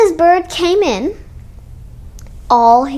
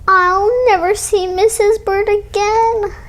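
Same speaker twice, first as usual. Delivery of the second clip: crying; 7 semitones higher than usual. Acted. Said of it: "he cried".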